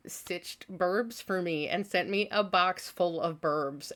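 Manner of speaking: ordinary delivery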